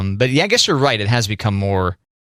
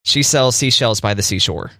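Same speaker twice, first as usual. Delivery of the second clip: tongue twister at a quicker clip; faster retry. Shown as a demonstration. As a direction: pick up the pace through the tongue twister. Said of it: "Now say it again faster".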